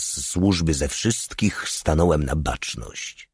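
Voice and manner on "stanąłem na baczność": usual speaking voice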